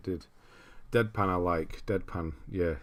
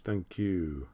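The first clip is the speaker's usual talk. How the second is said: normal talking voice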